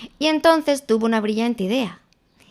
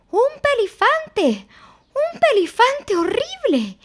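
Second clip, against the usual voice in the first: panicked squeal. A narrator's reading. Piglet running from the heffalump, panicked shriek. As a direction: shriek it while running away. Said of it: "gritó Porquet".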